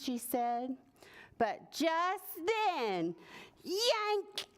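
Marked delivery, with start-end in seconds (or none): 1.4-3.1 slow, high storybook suspense; drawn-out, raised narrator lead-in; suspenseful. A narrator's reading. Narrator builds suspense before yank. 3.6-4.5 high-pitched storybook exclamation; high, startling sound word; sudden yank. A narrator's reading.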